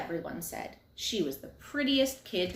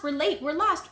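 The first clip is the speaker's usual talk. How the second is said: yelling; 8 semitones higher, 4 dB louder than usual. A narrator's reading. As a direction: keep calling out.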